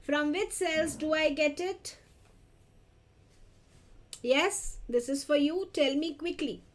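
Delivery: usual speaking voice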